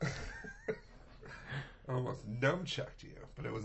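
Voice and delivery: slow, high, breathy laughing line. Real, not acted. Joking to co-host about nunchucks, laughing. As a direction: laugh through the joke threat.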